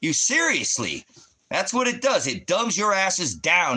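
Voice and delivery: high, loud, slow furious yelling; real furious rant, pitched high; angry shouting. Real, not acted. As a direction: yell the insults at them in real rage. Ranting at religious viewers.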